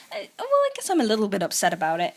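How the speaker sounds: quick, mildly exasperated admission; slightly frustrated. Retold or imitated. Chica admitting she's upset, slightly frustrated. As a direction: imitate composure starting to slip. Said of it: "gets slightly more frustrated".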